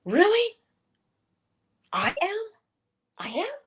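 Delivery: high, rising disbelieving question; as if you can't believe it's true; incredulous disbelief. Shown as a demonstration. Asking herself, not believing it, high incredulous rise. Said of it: "starts out as disbelief".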